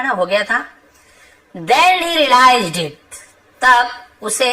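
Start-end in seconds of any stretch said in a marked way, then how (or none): 1.6-3.2 very slow, word by word, a bit louder; slow dictation. Shown as a demonstration. Teacher reading the lesson sentence to students, slow and deliberate. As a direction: read it out slowly so the class can follow.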